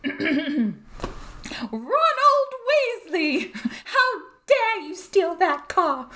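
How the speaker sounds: high, shrieking scolding mother; Molly Weasley howler. Pretend. Molly shrieking at Ron over the stolen car, high furious scolding. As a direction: screech it like a furious mum's letter. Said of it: "fun to say".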